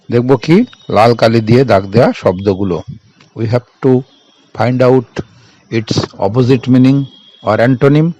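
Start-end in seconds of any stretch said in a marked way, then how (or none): 3.4-8.1 very slow, each word set apart; slow dictation. Shown as a demonstration. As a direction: spell it out slowly for the class. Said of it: "reading the task to the class".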